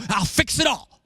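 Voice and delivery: husband who wants to fix everything, mocked; mock fixer husband. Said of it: "get in there and go".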